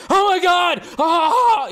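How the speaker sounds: high, loud mock squeal of "Oh my God, ah!"; over-the-top excited reactor. Host mocking hype reactors, high loud mock squeal. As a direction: ham up a screaming trailer reactor. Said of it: "I can't just be like".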